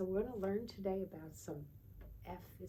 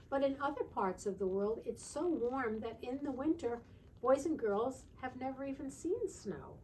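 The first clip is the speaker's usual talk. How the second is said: higher, louder storybook read; reading to children; 6 semitones higher, 6 dB louder than usual. Acted. Teacher reading about warm lands to children, higher storybook voice. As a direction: tell it with wonder. Reading picture book aloud.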